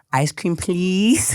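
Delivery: put-on slow, drawn-out little girl's plea; toddler pleading. A father imitating his daughter's slow sweet please for ice cream. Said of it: "She goes".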